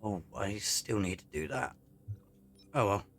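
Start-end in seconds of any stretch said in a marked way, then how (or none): none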